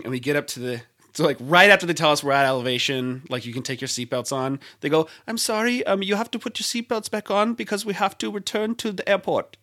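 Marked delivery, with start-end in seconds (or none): 5.3-9.6 imitating a polite flight attendant, higher; a lightly put-on cabin crew announcement.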